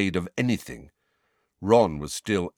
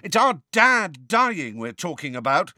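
a high raised yell; 10 semitones higher, 4 dB louder than usual. A narrator's reading. George yelling at Sirius about his dad, high and raised. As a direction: shout it back in a high voice. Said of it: "yelled George".

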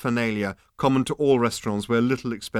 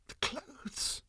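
whispered awe; 14 dB quieter than usual. Acted. Awed hushed listing.

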